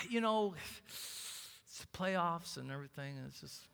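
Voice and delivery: low, slow, soft trailing excuse; sheepish excuse-maker. Acted. Church member blaming the playoffs to the pastor, sheepish and trailing off. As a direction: let the excuse trail away quietly.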